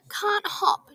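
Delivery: high, loud crying wail; tearful mumble. A narrator's reading. Missus Grey confessing to Big Red, crying. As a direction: blurt it out near tears. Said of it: "she mumbled".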